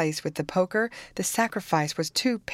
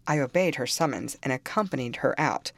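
ordinary delivery, nothing marked

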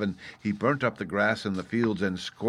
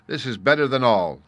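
proud boast; 4 semitones higher, 7 dB louder than usual. A narrator's reading. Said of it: "he said to himself".

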